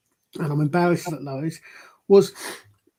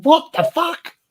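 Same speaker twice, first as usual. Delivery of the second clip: higher, shocked "what the fuck"; stunned disbelief; 9 semitones higher, slower than usual. Retold or imitated. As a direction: blurt it out like you can't believe your ears. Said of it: "I thought".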